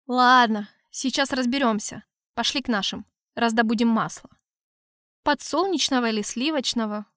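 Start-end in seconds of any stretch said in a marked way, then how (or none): none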